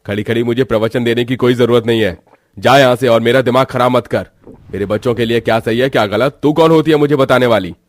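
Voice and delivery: loud, rising angry rebuke; angry shouting. Acted. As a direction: drive her out of the room. Master berates maid over drinking. (गुस्से में चिल्लाकर डांटना)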